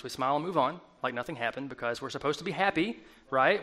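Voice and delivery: ordinary delivery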